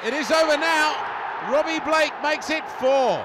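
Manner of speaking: loud, high shout on over; excited shout. Real, not acted. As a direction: call the clinching goal. Clinching goal, live call.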